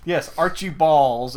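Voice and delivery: "Archie Balls" said loudly as two words; the name broken into "Archie Balls"; Archibald mispronounced. Retold or imitated. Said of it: "she mispronounces Archibald".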